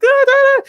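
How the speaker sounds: high, loud mock scream; repeated hammed-up panicky shrieks; retold frantic screaming while attacking; panicked scream. Retelling scream while spraying spider.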